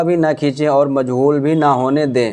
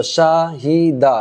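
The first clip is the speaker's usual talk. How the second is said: slow spelling-out; 2 semitones higher, much slower than usual. Shown as a demonstration. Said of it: "اس کو ہم جلدی سے پڑھیں گے".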